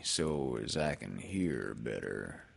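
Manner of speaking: slow, very low donkey voice; glum, ponderous explanation; Eeyore. A narrator's reading. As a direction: let it sink down as you plod through.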